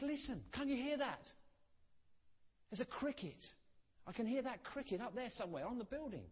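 high, eager pointing out a cricket; light, excited wonder at a tiny sound; eager countryman. Retold or imitated.

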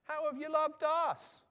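high, loud, drawn-out challenge; indignant retort. Acted. The people answering God back, indignant. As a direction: throw it back as a slow, indignant protest. Acting out the people's defiant response.

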